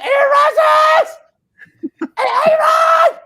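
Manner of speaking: high, slow, drawn-out shout of a name; retold excited yelling. Recreating yelling Aaron's name to his wife. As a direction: call out the name in delight. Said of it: "And I start screaming".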